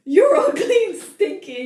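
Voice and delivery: high, loud mocking insult; Nesta. Reader imitates sister insulting the heroine, high mocking voice. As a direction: hurl it like a nasty sister. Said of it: "And Nesta goes".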